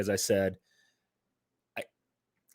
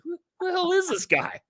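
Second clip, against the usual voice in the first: much higher incredulous question; mock disbelief. Recalling meeting his cousin's husband, higher pitched disbelief. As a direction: act out gawking at a stranger.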